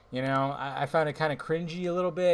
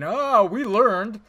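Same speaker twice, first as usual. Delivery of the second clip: mocking smug site; 6 semitones higher, 9 dB louder, much slower than usual. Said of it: "they're fucking".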